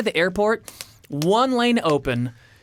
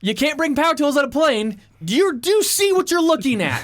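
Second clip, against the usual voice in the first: high-pitched indignant rant; freaking out; 9 semitones higher than usual. Retold or imitated. Traveler arguing with airport supervisor, freaking out. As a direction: throw the rule back, exasperated and high. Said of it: "I'm freaking out".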